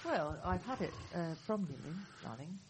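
muffled, as if through a wall; dull, far-off muffled sound. Real, not acted. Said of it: "I'm very muffled".

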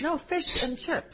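put-on teasing a guest with a New Zealand accent; mock New Zealand accent. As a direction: drag out Fish and chips in a jokey New Zealand accent. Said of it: "Don't do the accent".

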